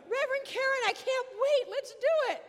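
high, loud burst of eagerness; eager excited shout. Retold or imitated. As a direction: shout it like he can't wait another second. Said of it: "would say".

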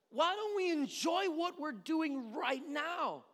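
high, loud, exasperated lecture; passionate dad. Retold or imitated. Dad urging kids to enjoy the moment, passionate. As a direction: plead loudly with the kids, worked up. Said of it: "I get especially passionate".

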